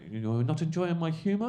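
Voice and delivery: high, loud mock query rising at the end; comic needling heckler. Comic to man in the front row, high needling query.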